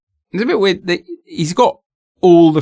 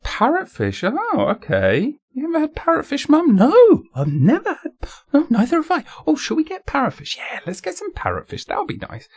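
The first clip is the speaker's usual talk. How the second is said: high mock voices. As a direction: play both parts, light and fluting. Mother and son voiced chat.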